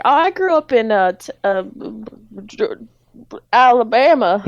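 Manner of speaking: hesitate, then hammed-up southern place name; improvising a southern backstory; Speed's southern accent. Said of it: "everyone in this grocery store have a southern accent".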